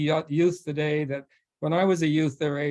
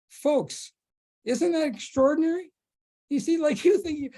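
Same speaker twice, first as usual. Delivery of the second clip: delighted amazement; 11 semitones higher than usual. Real, not acted. Marvelling at the story to audience.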